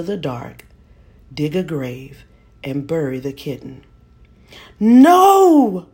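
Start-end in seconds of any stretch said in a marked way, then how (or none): 4.8-5.9 put-on high, loud child's scream of no; terrified scream. A narrator's reading. Boy screaming no at his mother's order. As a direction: scream it out in terror. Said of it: "I screamed".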